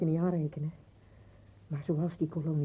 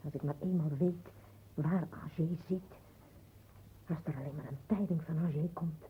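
zacht verlangend; 9 dB quieter than usual. Acted. Vrouw verlangt naar zoon.

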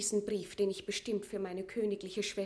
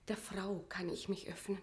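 leise, vertraulich geflüstert; innig geflüstert; 3 semitones lower, 8 dB quieter than usual. Acted. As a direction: wie ein Geständnis flüstern. Maria vertraulich über Elisabeth. (Maria flüstert Paulet vertraulich zu)

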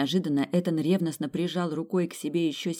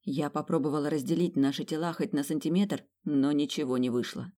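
ordinary delivery, nothing marked

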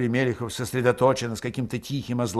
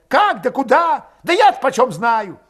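high, loud, exasperated retort; irritated outburst; 13 semitones higher, 7 dB louder than usual. A narrator's reading. Valet answering where they should flee, exasperated. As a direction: throw the questions back loudly. Same quarrel, Valet snapping back.

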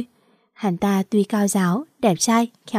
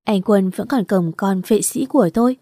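usual speaking voice

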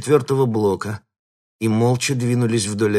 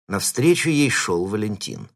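usual speaking voice

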